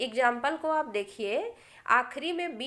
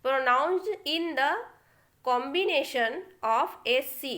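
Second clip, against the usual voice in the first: very slow, deliberate reading; slow rule reading; much slower than usual. Shown as a demonstration. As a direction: read it out slowly for the class. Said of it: "इसका rule one है". (धीमी गति से नियम पढ़ना)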